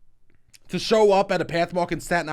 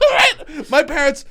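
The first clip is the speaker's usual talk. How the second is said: much higher, laughing through the words; 3 dB louder, slower than usual. Real, not acted. Telling host about his parents, laughing.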